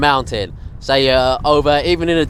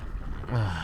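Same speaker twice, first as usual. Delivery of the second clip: soft drained sigh; weary sigh; 4 semitones lower, 13 dB quieter than usual. Real, not acted. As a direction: sigh from exhaustion. Seasick at night.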